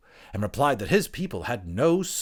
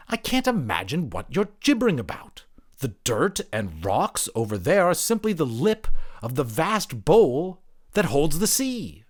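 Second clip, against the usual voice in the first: higher, dismissive, slowing at the end; scornful creature; 8 semitones higher, 5 dB louder than usual. Acted. Creature dismissing the anthropologist's talk of land, higher and scornful. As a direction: brush off his nonsense.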